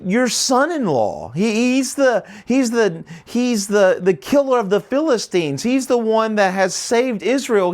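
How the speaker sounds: high, pleading priest begging the king; frightened priest insisting to the angry king that he knew nothing; desperate pleading. Retold or imitated. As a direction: plead your innocence, voice climbing high. Priest pleading for his life.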